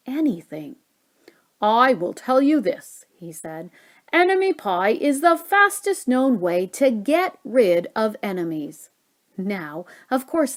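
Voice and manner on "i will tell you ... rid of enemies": louder, confident dad announcing a secret; dad's voice. A narrator's reading. Narrator as dad telling his son the pie's secret, louder. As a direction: proclaim it like a proud chef.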